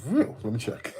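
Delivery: sudden jump up high and loud on real, then a laughing let me check; startled disbelief. Retold or imitated. Streamer told he is buffering, shocked disbelief. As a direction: caught off guard, checking in a rush. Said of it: "I was like".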